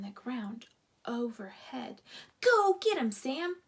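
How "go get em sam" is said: high, loud cheer for the dog; urging yell. A narrator's reading. Boy urges the dog on, loud cheer. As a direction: egg the dog on. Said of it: "urged another boy".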